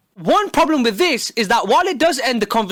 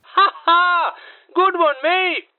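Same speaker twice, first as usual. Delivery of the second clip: forced phone laugh, higher; fake laugh; 4 semitones higher, slower than usual. Pretending to enjoy the call, forced laugh. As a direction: laugh along falsely. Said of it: "It'd go a little something like this".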